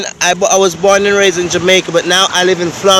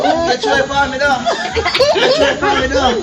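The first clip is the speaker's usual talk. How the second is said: words breaking into high laughter; laughing; 8 semitones higher, 3 dB quieter than usual. Real, not acted. Laughing as he takes his turn at the game. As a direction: laugh through it while grabbing the phone.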